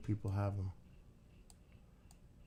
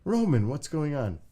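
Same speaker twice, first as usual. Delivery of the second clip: high, loud call to the dog; 7 semitones higher, 7 dB louder than usual. Real, not acted.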